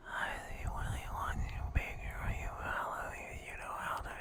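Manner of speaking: hushed, unvoiced murmur from afar; distant whisper. Voice drifting up from downstairs, whispered. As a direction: whisper it as if overheard through floors.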